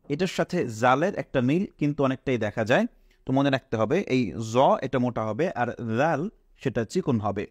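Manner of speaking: ordinary delivery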